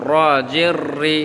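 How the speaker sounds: 'র' and 'রি' with a rolled R; rolled tongue R. Shown as a demonstration. Teacher shows learners the letter, 'রি' rolled. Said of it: "জিহ্বা ঘুরিয়ে বলতে হবে".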